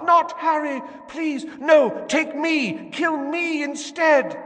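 high, loud pleading scream; desperate screaming. A narrator's reading. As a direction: beg for her child's life. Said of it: "Someone was screaming".

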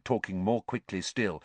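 plain reading voice

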